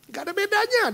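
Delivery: high, loud exasperated rant; sick of hearing it, let it explode; husband fed up. Acted. Husband snapping at his wife's faith talk, high and loud. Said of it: "dia bilang".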